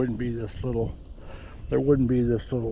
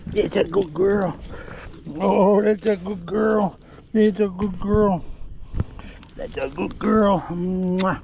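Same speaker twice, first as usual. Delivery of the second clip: praising the dog; 6 semitones higher, slower than usual. Real, not acted. Handler doting on his dog while she eats the hide. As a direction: coo over her, drawn out.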